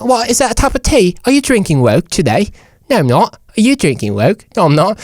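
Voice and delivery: high-pitched mock British chatter; bad British accent. Two Brits chatting over tea, bad British accent. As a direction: do a badly faked British accent. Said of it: "you're laughing at my accent so bad".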